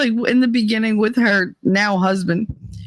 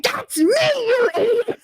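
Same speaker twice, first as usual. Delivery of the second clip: high, slow, laughing yell; laughing shout; 11 semitones higher than usual. Retold or imitated. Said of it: "She was like".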